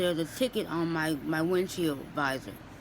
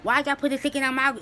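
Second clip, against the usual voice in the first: mock argumentative; 6 semitones higher, 4 dB louder, faster than usual. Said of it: "I don't go off and get into an argument".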